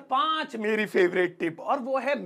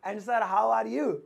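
long polite answer; 3 semitones lower, much slower than usual. Shown as a demonstration. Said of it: "थोड़ा लंबा खींच लो".

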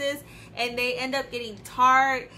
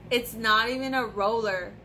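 ordinary talk

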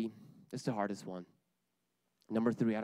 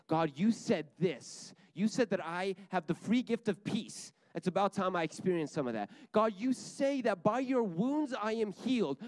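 bold declaring prayer; 6 semitones higher than usual. Acted. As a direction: hold God to his word, stretching the key words. Models bold prayer claiming God's promises.

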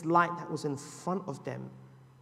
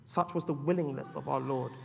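ordinary talk

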